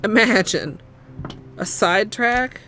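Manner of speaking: loud, playful outburst; loud exclaim. Real, not acted. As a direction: burst out dramatically. Loud mock-dramatic exclaim.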